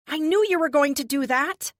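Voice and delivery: high, loud holler. A narrator's reading. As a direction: yell it across the room. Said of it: "I holler at Kate".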